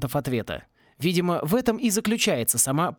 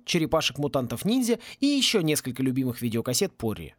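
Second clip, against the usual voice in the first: ordinary delivery, nothing marked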